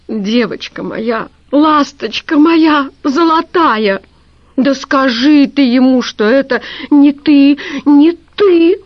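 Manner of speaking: rising to a high, loud wail; begging her niece, voice climbing into a cry; desperate pleading. A narrator's reading. (тётя умоляет племянницу, срываясь на высокий громкий крик)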